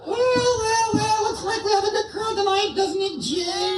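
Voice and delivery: squeaky high puppet voice, shouted out; a cheeky dummy yelling hello from the box; ventriloquist dummy Bob. Acted. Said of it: "we're gonna do some ventriloquism here".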